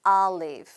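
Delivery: olive starting with an open "ah", "ah-liv". Shown as a demonstration. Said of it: "아 발음처럼 하시면 됩니다". (olive를 "알리브"처럼 아 소리로)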